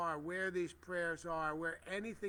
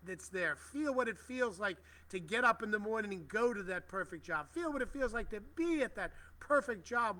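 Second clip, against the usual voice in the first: higher, louder, urging affirmation; rousing guided visualization; 4 semitones higher, 4 dB louder than usual. Acted. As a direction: paint the dream job, lifting the listeners. Leads a visualization of the job.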